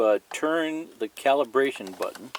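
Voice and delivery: ordinary delivery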